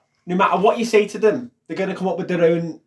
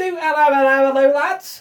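higher put-on hello chant; catchphrase greeting; 8 semitones higher than usual. Shown as a demonstration. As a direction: sing out the greeting to the lads. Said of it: "Where are we up to?".

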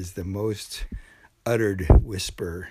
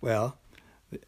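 ordinary talk

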